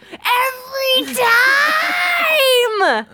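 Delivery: high, loud, very drawn-out yell; yelling at the dog. Retold or imitated. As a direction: let the anger explode in one long yell. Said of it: "I yelled right in his little face".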